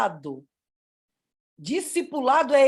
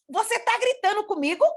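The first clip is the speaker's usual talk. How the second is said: high-pitched indignant question; mock-offended rising question; indignant challenge; 9 semitones higher than usual. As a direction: imitate a scolding adult, pitched up. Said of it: "na hora ele abaixou a voz".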